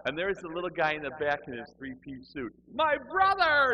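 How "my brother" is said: very high, loud, drawn-out mock joy; effusive greeting. Little man in the back hugging him as he leaves, effusively. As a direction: shriek a long, overjoyed hello.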